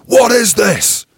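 high, loud shout with the second slow; retold coach yelling in rage; furious shout. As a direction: roar it across the breakfast room. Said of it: "He's like".

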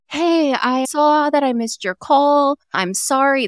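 cheery, high, slow husband voice; happy husband. Retold or imitated. Husband at a rowdy bar cheerfully returns his wife's call, high and slow. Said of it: "he just sounds happy as can be".

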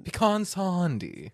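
slow "Pecan sandy" with open ah, SAHN-dee; puh-KAHN attempt. Shown as a demonstration. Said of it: "I can't even pronounce".

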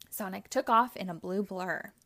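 ordinary delivery

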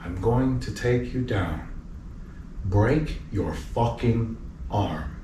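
slow, low, measured threat; deadly calm, word by word; quiet menace. Retold or imitated. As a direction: threaten softly, pausing between phrases. Retells his cold threat to the player.

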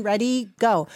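usual speaking voice

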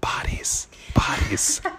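eerie whispered bodies; creepy repeated whisper. Pretend. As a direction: hiss it like a ghost. Spooky whispered chant.

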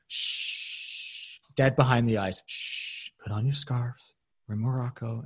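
soft, hushed shushing; hushing whisper. Retold or imitated. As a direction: shush her down coldly. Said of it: "Kelly goes".

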